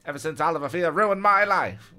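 screaming. A narrator's reading. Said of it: "Blue Eyes screamed".

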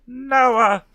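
high, loud exclamation; loud, dramatic high cry. A narrator's reading. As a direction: cry it out, pitch way up. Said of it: "Tracy cried".

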